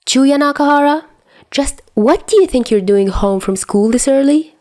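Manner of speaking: high, pointed full-name scolding; a mother catching him out; stern scolding. A narrator's reading. Mother catching son skipping school, stern scolding.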